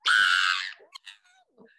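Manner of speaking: loud low exasperated shout of Ah; frustrated yell. Retold or imitated. Mom seeing cereal all over the floor, exasperated yell. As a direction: let out a burst of parental frustration. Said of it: "you just wanna be like".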